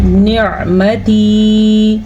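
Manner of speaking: نعمتی slow, its ع voiced clearly from the throat; نعمتی chanted with a distinct ع; clear ain. Shown as a demonstration. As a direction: model the ع of نعمتی slowly and clearly. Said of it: "آئین کا مخرج کیسے ہوتا ہے".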